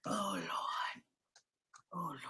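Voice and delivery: soft, low groan of dismay; breathy moan. Real, not acted. Guest overwhelmed by spirits, soft dismayed moan. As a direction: moan it under your breath.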